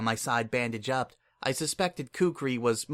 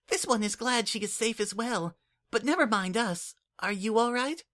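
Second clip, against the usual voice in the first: put-on much higher, earnest little changeling kid; Kukri's voice; 10 semitones higher than usual. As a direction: speak up like an eager, caring child. Kukri speaks.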